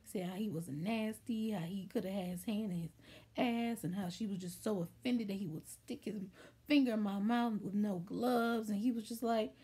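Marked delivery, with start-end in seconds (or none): none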